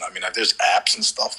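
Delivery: ordinary talk, nothing marked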